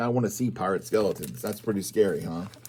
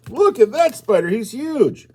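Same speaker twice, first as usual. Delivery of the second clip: mock alarm; 11 semitones higher, 6 dB louder than usual. Spider fright continues.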